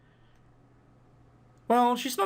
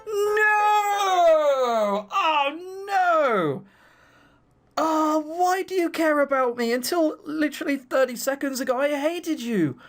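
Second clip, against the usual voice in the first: higher, louder hammed-up wail; overacted tearful cry; mock sobbing; 10 semitones higher, 4 dB louder, much slower than usual. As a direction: melodramatic fake blubbering.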